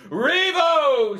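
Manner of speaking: put-on loud high drunken cry; town drunk. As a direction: bellow it like a tavern drunk. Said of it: "says the drunkard".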